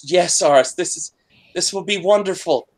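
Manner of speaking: a hint of a delighted woman's voice in the mind; her voice in your head. GM voicing the spirit in Aris's head, lightly delighted. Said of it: "She's, she's".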